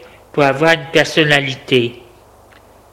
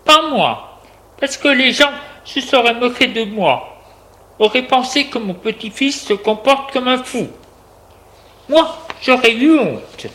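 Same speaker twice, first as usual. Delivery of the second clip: high-pitched indignant telling-off; higher, insistent reproach; scolding reproach; 8 semitones higher than usual. A narrator's reading. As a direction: shame him with a lifted voice. Grandmother shames her grandson.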